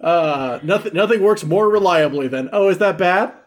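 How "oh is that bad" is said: feigned ignorance. Retold or imitated. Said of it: "the old feigned ignorance punchline".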